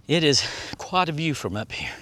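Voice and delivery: ordinary delivery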